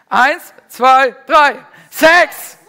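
rally the room, shout it; shouted countdown. Real, not acted. Preacher firing up the congregation, shouted countdown.

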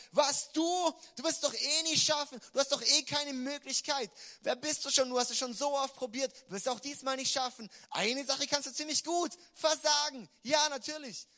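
the giant in your head mocking your failure; taunting giant voice. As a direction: jeer down at the listener. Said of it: "dann wird er erst richtig anfangen".

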